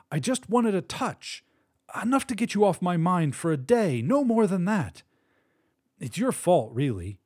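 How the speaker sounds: pushing the blame, voice up high; high strained voice. A narrator's reading. Paolo blames wife, voiced higher.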